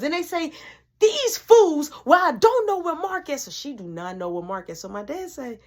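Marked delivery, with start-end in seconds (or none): 1.0-3.4 high, loud huffy neighbor; neighbor complaining. Retold or imitated. Neighbors at the door, high and huffy. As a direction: grumble about the fools.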